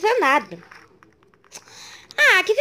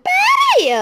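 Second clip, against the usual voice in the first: shout; 14 semitones higher, 5 dB louder than usual. Real, not acted. Protesting in game.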